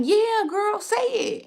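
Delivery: high, loud retold cheer; excited egging-on. Vlogger replays cheering on her Swedish waiter, excited and high.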